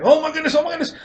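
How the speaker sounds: very high, frantic dog voice; excited dog voice. Retold or imitated. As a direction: voice the dog going nuts in his crate. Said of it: "He's like".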